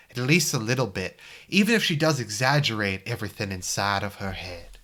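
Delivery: put-on old-school Virginia gentleman; hammy Southern accent from a Civil War movie. Narrating his thoughts about a worried friend, Virginia accent. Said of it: "Okay, I'll do my Virginia accent".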